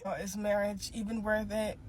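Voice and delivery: ordinary talk, nothing marked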